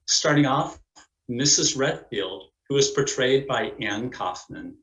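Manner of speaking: usual speaking voice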